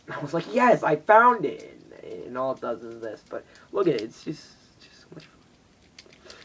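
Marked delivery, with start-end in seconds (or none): 0.5-1.8 high, loud and slow triumphant cry. Retold or imitated. Retelling finding it, loud and slow triumph. Triumphant find of the closet item. 4.0-6.3 breathy whispered gush. Real, not acted. Showing viewers the toy, in a delighted whisper. Hushed gushing over the toy.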